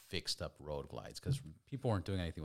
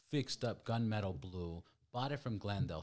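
ordinary talk, nothing marked

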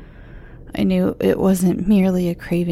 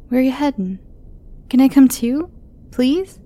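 put-on high, hesitant young girl; worried young girl voice; teen girl voice; 7 semitones higher, 4 dB louder than usual.

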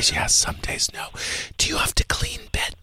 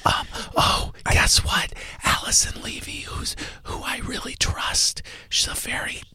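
hushed radio host whisper; breathy secretive whisper on air; 6 semitones lower than usual. Acted. Host whispers all show.

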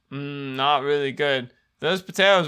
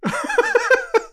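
squeaky high-pitched giggle. Real, not acted. High laugh alone.

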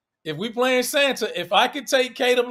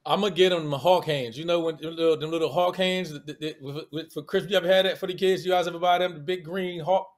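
usual speaking voice

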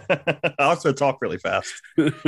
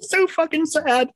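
mock despair. Real, not acted. Mock lament.

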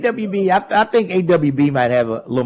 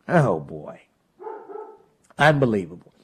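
ordinary delivery

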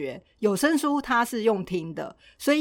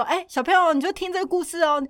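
put-on higher, sweet coaxing voice to a kid; mock parent coaxing child; 8 semitones higher than usual. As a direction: play a parent palming the kid off onto a CD. Parents handing kids a story CD. (模仿家长哄小孩，语调偏高)

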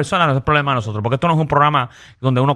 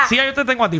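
laughing; 10 semitones higher than usual. Real, not acted. Teasing co-host, laughing.

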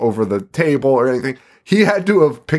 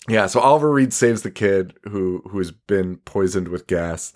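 normal talking voice

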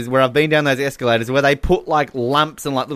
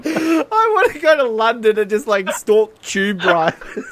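laughing; 7 semitones higher than usual. Real, not acted. Joking about stalking.

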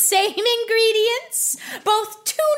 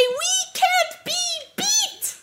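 mock slogan; 7 semitones higher, 4 dB quieter, slower than usual. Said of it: "You're supposed to say".

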